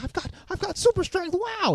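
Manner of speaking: very high falsetto wonder; hammed-up high-pitched amazement; mock amazed discovery. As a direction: squeak it out in pretend astonishment. Voicing boy discovering his powers.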